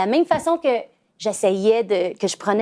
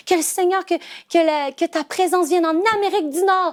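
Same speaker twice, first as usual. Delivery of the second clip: imitating her son praying; 8 semitones higher than usual. Mother voices her son praying for North America, higher and fervent. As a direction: voice the little boy calling out earnestly.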